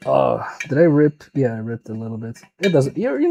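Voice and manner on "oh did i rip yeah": usual speaking voice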